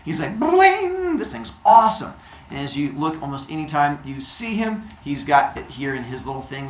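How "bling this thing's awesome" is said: high ringing twang, then loud delight; thrilled with a new toy; acted gleeful enthusiasm; excited. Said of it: "And Apollo's excited".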